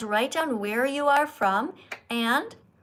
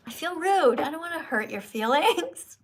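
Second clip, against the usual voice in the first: normal talking voice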